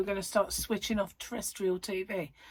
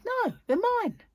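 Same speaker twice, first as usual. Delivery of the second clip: high-pitched, louder punchline; 5 dB louder, slower than usual. Retold or imitated. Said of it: "I said".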